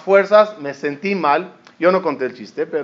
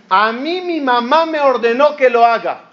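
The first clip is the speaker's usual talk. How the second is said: raised, insistent quoted voice; indignant retort; 5 semitones higher than usual. Retold or imitated. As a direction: protest that you only obeyed your mother. Retelling a quarrel, Jacob speaking.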